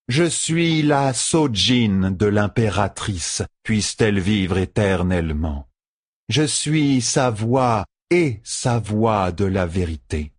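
very slow, stately declaration; solemn imperial servant. Acted. Seleucia declaring herself the Empress's voice, solemnly. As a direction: intone the title with measured dignity.

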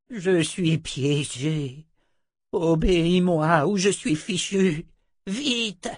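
high, slow, strained plea; desperate croaking wizard. A narrator's reading. Trapped wizard begging her to obey, desperate croak. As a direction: drag the words out urgently, pitched high.

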